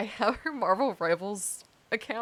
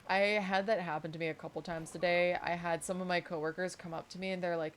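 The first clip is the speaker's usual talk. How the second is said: usual speaking voice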